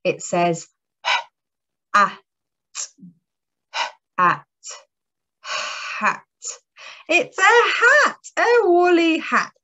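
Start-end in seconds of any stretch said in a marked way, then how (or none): 1.9-6.2 at, at, hat split into sounds, last one breathed; sounding out phonics. Shown as a demonstration. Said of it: "I'm going to sound out". 7.1-9.5 teacher thrilled by the answer; excited reveal. Acted.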